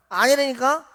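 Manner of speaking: loud, insistent no; irritated denial. Acted. Peter denying to the servant, loud and irritated. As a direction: brush them off, annoyed. Peter denies again, louder.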